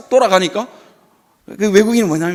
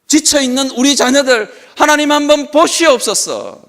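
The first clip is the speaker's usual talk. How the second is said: fervent preacher's plea; beseeching prayer; 7 semitones higher than usual. Acted. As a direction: implore God, phrase by phrase. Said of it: "그렇게 기도하는 것입니다".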